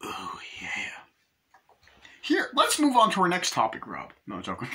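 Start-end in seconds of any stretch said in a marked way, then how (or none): 0.0-0.7 soft whispered aside. Real, not acted. Reviewer whispers a quick aside to himself. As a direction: breathe it under your voice.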